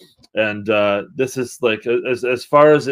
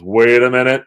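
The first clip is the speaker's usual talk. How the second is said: louder quoted sample, lightly imitated; 7 dB louder than usual. Podcaster quoting a sample in a song, lightly imitated. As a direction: imitate a sampled voice cutting in, punchy. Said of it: "there's a voice that goes".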